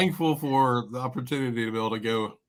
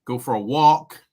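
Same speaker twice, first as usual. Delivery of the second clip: imitated Boston accent; 7 dB louder than usual. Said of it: "going up to somebody in Boston".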